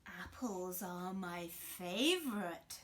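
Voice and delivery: slow, drawn-out wolf; slow sly wolf. Acted. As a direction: savour it like a hungry wolf. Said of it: "said the wolf".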